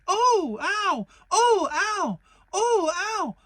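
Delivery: bouncing oh ow. Acted. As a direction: yelp with every bounce. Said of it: "he was saying".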